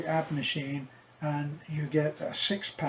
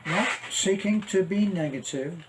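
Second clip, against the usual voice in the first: ordinary talk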